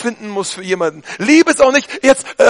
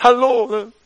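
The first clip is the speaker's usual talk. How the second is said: sugary put-on churchgoer greeting; mock the staged Sunday niceness; hammed-up sweet greeting with a whiny crack; fake piety. Mocking fake Sunday friendliness.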